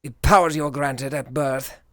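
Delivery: low, soft brooding loner voice with a put-on accent; bitter outcast muttering his scorn; Joseph's bad accent. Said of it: "Joseph was a depressed asshole with a bad accent".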